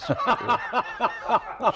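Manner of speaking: real high burst of laughter; a real helpless laugh; hearty laugh. Real, not acted. Laughing at his own joke.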